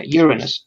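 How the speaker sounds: Uranus as YUR-uh-nus; light middle syllable in Uranus, stress first; correct scientific pronunciation. Shown as a demonstration. Friend confirming the actual way, Uranus as YUR-uh-nus. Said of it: "that's the actual way of pronouncing".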